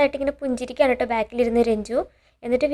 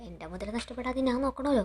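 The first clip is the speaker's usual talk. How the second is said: soft, hushed aside under the breath; a muttered soft aside; said softly; 10 dB quieter than usual. Retold or imitated. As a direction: say it quietly so only she hears. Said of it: "Geetha ഇങ്ങനെ പയ്യെ പറയാണ്".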